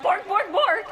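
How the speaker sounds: loud barking imitation; imitated dog bark. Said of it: "it's just like".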